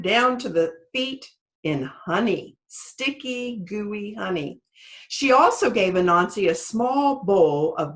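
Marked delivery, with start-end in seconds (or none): none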